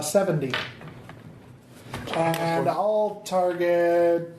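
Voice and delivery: ordinary talk, nothing marked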